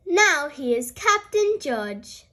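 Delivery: plain reading voice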